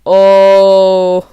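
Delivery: loud excited squeal. Real, not acted. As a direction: let out a fan squeal. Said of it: "immediately squealed".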